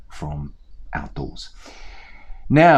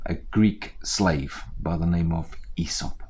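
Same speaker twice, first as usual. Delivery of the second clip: plain reading voice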